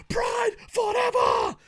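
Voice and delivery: drawn-out yell of a battle cry. Acted. Reviewer hyping the greatest Pride fighter, yelling. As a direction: yell it slowly, stretching the words. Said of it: "me yelling the word forever".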